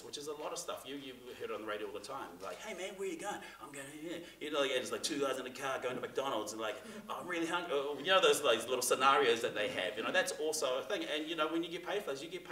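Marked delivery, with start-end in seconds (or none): 2.6-4.2 character friend voice. Shown as a demonstration. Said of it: "the character friend voice". 7.1-7.7 character friend voice. Shown as a demonstration. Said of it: "the character friend voice".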